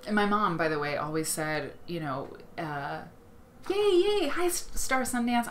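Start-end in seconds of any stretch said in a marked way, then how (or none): 3.7-5.5 high, loud cheer sliding back down; excited greeting. Real, not acted. Host welcomes a viewer to the stream, excited. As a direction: cheer a newcomer's arrival, starting high.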